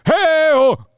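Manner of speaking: high, loud call-out; crowd-hyping shout. Shown as a demonstration. Showing what to say to the crowd, hype shout. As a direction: holler it for the crowd to echo. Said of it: "shout into the microphone".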